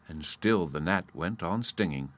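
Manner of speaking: plain reading voice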